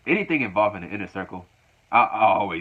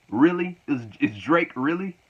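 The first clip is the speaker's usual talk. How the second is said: mock incredulous; 6 semitones higher than usual. [name] mocking the [name] lyrics.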